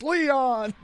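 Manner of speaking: high, imitated cry of grief for a fallen comrade; hammed-up generic "someone's dead" yell; mock death yell. Player imitating a generic game death scene, mock yell. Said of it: "generic yell of "no, someone's dead".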